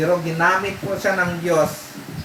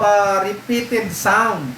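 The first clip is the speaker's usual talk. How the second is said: normal talking voice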